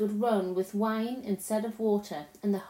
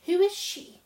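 put-on high, louder child's question; wide-eyed little-girl query; puzzled Lucy; 10 semitones higher, 6 dB louder than usual.

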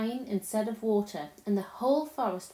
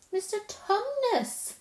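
high, drawn-out call; bawled; 11 semitones higher, slower than usual. A narrator's reading. Lucy tries to get through to the sobbing faun, bawling. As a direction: bawl the name slowly into his ear. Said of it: "bawled Lucy in her ear".

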